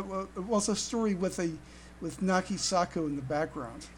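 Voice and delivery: ordinary delivery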